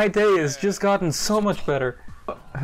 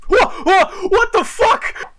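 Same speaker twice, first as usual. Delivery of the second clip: high, loud, slow disbelieving yell; shocked shout; 12 semitones higher, 5 dB louder, much slower than usual. Real, not acted. Shocked at enemy taunt.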